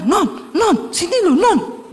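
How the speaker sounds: high, calling his wife across the house; a husband yelling for his wife, half-acted; retold calling out. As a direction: shout her nickname from the other room. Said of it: "Dia teriak-teriak".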